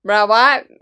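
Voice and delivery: loud, baffled mock outcry; imitating outrage. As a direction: throw out a stunned "what" at the screen. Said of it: "You were so disappointed".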